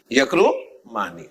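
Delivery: یکرمانی said slowly with a long falling آنی ending; slow, low model of یکرمانی; drawn-out ending. Shown as a demonstration. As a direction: stretch out the آنی at the end of یکرمانی. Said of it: "آنی کی آواز کے ساتھ بنے گا".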